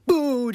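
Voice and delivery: sudden loud playful boo; jump-scare the viewer; startling boo. Pretend. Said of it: "Did I scare you?".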